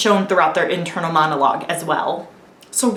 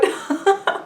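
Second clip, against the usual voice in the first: short laugh at own joke; 10 semitones higher than usual. Real, not acted. Vlogger amused by her own silly line, short laugh. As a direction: let out a brief, amused laugh.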